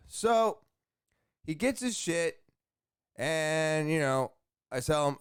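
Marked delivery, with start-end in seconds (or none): none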